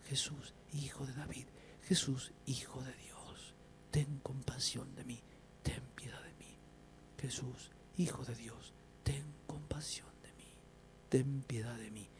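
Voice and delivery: soft, murmured repeated plea; hushed prayer. Retold or imitated. Believer praying alone before the Scripture, hushed plea. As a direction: pray it under your breath, phrase by phrase.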